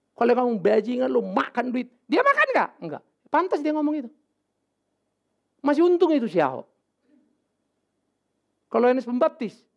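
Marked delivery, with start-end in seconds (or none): none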